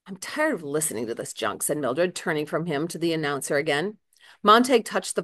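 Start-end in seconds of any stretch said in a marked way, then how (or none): 0.1-1.6 weary irritation. Acted. Mildred irritated, turning away.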